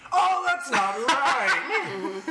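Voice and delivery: high, slow panicked whine; Guy from Galaxy Quest. Retold or imitated. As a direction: wail it out slowly in mock panic. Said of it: "thinking of Guy from Galaxy Quest".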